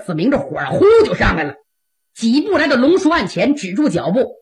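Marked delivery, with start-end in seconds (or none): none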